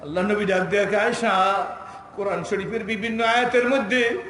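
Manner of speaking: loud, high melodic sermon; ringing, chanted proclamation; impassioned preaching. Acted. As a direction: drive each phrase out with full force. Preaches at full force.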